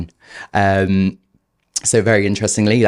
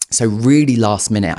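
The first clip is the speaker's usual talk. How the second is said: ordinary delivery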